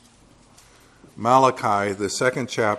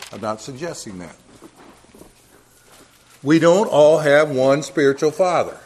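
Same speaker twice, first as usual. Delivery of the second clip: louder, insistent pulpit preaching; 2 semitones higher, 4 dB louder than usual. Acted. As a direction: drive the point home from the pulpit. Preaching at full force.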